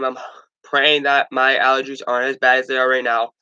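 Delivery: usual speaking voice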